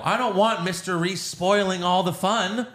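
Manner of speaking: high, sing-song villain; mock-petulant villain with a questioning lilt; Joker. Villain calling a show about the man spoiling things, high and playful. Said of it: "So Joker's like".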